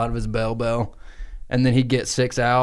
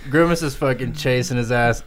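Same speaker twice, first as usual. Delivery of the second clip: normal talking voice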